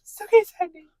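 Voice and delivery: loud, high coaxing; hammed-up reassurance; high mock soothing. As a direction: coax her in a squeaky voice. Soothing the ghost, playfully.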